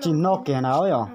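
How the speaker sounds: loud and fast, speaking up; to someone hard of hearing. Real, not acted. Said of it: "phải nói to to bà cụ mới nghe được".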